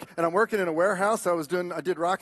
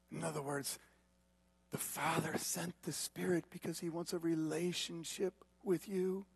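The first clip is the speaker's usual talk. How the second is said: soft, intimate closing appeal; quiet, lingering pulpit delivery, slowing at the end; hushed preaching; 8 dB quieter, slower than usual. Real, not acted.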